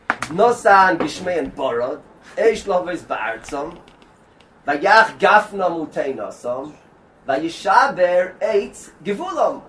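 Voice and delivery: slow, measured reading of the verse; slow chanted verse reading; verse recital. Acted. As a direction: lay the verse out word by word, slowly.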